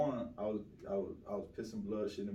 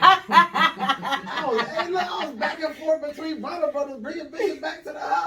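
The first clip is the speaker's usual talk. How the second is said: high laughing rush of words, much louder; 13 semitones higher, 9 dB louder than usual. Real, not acted. Bragging to friends about the weekend, laughing hard. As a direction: laughs through the brag, voice way up.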